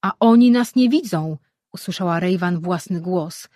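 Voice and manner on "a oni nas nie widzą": higher and louder, carrying over the crowd; calling out. A narrator's reading. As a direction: call out to steady everyone. Rejwan calls out over crowd.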